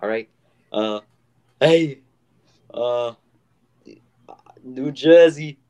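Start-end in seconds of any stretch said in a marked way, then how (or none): 0.7-3.1 put-on New Jersey accent. Host trying out a requested accent, New Jersey accent. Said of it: "how about we talk with the New Jersey accent". 4.6-5.6 loud put-on New Jersey accent. Host doing a listener's request, New Jersey accent. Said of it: "that was my impression of a New York, New Jersey person".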